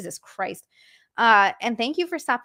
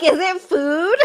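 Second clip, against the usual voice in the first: laughing quote; 4 semitones higher, 7 dB louder, slower than usual. Retold or imitated. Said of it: "I'm like".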